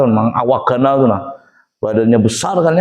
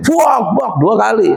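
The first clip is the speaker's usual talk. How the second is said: higher, raised mock outburst; angry soldier; 6 semitones higher, slower than usual. Soldier angrily shoving.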